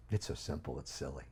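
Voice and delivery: soft aside. Real, not acted. Quiet aside after peak.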